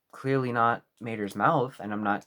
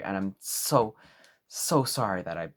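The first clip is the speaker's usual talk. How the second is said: usual speaking voice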